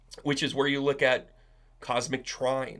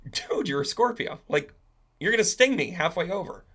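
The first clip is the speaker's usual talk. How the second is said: higher-pitched frog protesting; acted frog, pitched up in protest; wary frog; 6 semitones higher than usual. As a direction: object as the doubtful frog. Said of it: "And the frog says".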